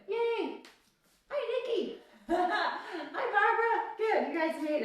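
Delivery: high, bright squeal of hello; excited greeting. Real, not acted. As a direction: greet arriving friends with delight. Greeting students joining the class.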